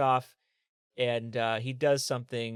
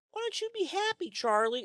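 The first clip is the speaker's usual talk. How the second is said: much higher sing-song mockery; mock chirpy nagger. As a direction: parody a pushy cheer-up person. Mocking someone telling Charlie cheer up.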